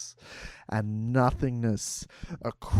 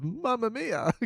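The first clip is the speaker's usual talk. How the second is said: mock Italian cry; 7 semitones higher than usual. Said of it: "Just cries of".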